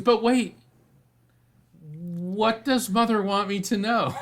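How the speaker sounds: high, loud and pleading, then slowing with a laugh; eager churchwoman. Retold or imitated. Churchwoman calling the medium back, high eager plea. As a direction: grab him back, suddenly desperate to hear.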